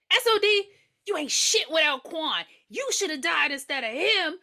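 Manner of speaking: high, loud mock accusation; acted angry confrontation. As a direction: lay into him like an angry heckler.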